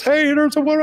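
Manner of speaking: very high-pitched imitated alarm; urgent passenger. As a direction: pipe up as a mock-desperate passenger. Passenger urgently spots an exit.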